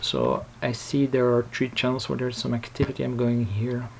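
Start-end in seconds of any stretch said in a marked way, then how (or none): none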